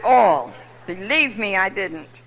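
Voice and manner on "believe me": ordinary talk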